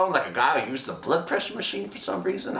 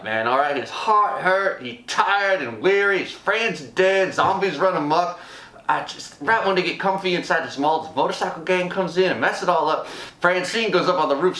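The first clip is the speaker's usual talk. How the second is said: usual speaking voice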